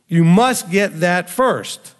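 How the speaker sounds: deliberate, weighty warning; slow emphatic insistence. Real, not acted. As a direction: stress on must, said very slowly. Urging hearers to grasp fear.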